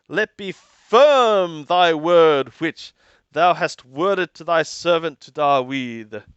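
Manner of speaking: ordinary talk, nothing marked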